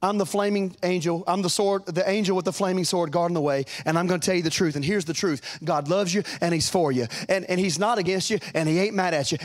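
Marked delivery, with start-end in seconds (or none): none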